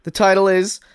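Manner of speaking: ordinary delivery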